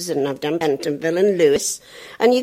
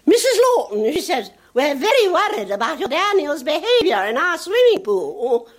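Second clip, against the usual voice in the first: headmistress; 10 semitones higher than usual. Retold or imitated. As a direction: speak like a worried teacher. Headmistress voiced.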